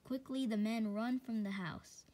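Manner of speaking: usual speaking voice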